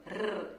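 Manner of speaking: Rrr trilled behind the teeth; rolled r. Shown as a demonstration. Teacher showing the tongue trill to students. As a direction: roll the Rrr on the tongue tip. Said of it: "Estão vendo a minha língua?".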